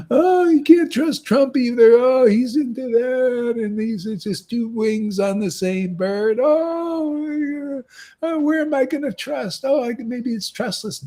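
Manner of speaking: high-pitched, hammed-up lament; mock wailing complaint. Preacher mimicking someone who can't trust Trump either, mock wailing.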